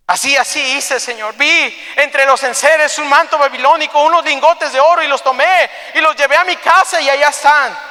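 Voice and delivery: high, urgent acted confession; impassioned confession. Achan confessing the theft to Joshua, impassioned. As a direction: pour it out with building force.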